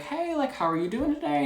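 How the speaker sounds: customer service voice. Retold or imitated. Cashier greeting a regular contractor at checkout, sugary service voice. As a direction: chirp it like a cashier on shift.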